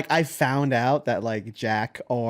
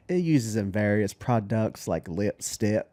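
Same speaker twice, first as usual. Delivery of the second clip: put-on lower, slow Southern child's drawl; Southern kid voice; 5 semitones lower, 4 dB quieter, much slower than usual. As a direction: drawl it like an Alabama boy. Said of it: "He's like".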